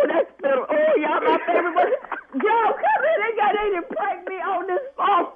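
ordinary talk, nothing marked

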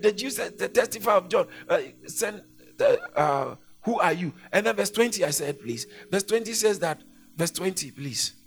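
loud, driving sermon delivery, pressing each phrase; preaching at full force. Acted. Preacher thundering John's confession to the church. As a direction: proclaim it over the congregation. Preaching John chapter one aloud.

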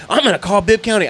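threatening neighbor. Retold or imitated. Mimicking the neighbor threatening to call the county, shrill mock voice. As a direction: squeal the threat like a busybody. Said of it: "she gave me the whole".

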